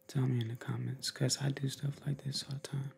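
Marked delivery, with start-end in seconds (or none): 1.1-2.9 ASMR whisper. Real, not acted. Vlogger explaining his ASMR habit, whisper. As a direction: whisper it confidingly. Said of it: "I get my A S M R on".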